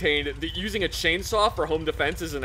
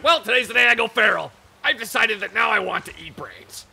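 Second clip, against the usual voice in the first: louder, higher put-on ghoul announcement; mock feral ghoul; 7 semitones higher, 4 dB louder than usual. Speaker imagines a ghoul waking up feral, high mock announcement.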